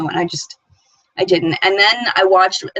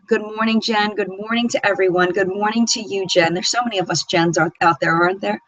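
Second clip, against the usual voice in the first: ordinary talk, nothing marked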